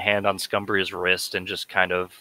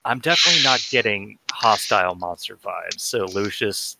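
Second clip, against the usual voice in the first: ordinary talk, nothing marked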